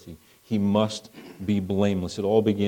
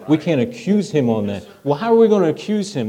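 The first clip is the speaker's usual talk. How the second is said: exasperated plotters; 6 semitones higher, 5 dB louder than usual. Retold or imitated. Politicians stumped over accusing Daniel, mock exasperation. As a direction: voice rising higher and louder in mock frustration.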